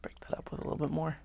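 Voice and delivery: whispering. Real, not acted. Whispered aside.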